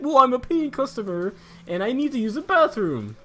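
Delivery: high, loud mock-entitled complaint; hammed-up impression of an irate customer; imitated yelling customer. Paying customer insisting on the bathroom to clerk, mock yelling. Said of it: "he's yelling at me".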